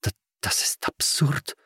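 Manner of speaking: halting, hushed disbelief; soft, breath-caught stutter; stammering. A narrator's reading. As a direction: stumble on the first word, taken aback. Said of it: "stotterte Edeltraud".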